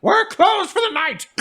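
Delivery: high, loud yell out the door. Acted. Herb yelling out the tavern door, high loud yell. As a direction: bellow it into the night. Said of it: "he yells".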